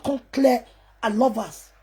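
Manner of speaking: ordinary talk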